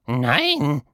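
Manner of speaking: high little creature voice, a touch offended; almost offended. Acted. Caterpillar asked if it is scary, high and a touch offended. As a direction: sound slightly insulted by the question. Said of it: "Die Stimme klang fast beleidigt".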